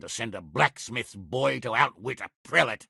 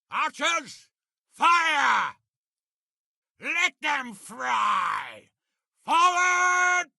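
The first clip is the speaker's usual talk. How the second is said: battle command shout; much slower than usual. Acted. Commander ordering his archers to fire, shouting over battle.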